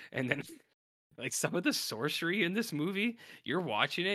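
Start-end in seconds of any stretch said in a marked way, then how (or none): none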